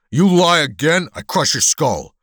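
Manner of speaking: loud, high-pitched menacing thug; threatening shout. Acted. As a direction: shout the threat to intimidate the bartender. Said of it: "the hulk shouted".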